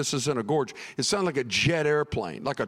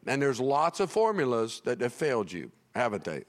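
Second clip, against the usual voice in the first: ordinary talk, nothing marked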